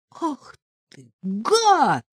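outraged shout; woman shouting at the lover who hung up. A narrator's reading. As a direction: yell the insult. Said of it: "закричала я".

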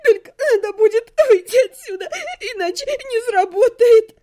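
high, sobbing wail; bawling. A narrator's reading. Captive begging to be let go, crying hard. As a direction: beg through sobs, pitch right up. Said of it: "разревелась я".

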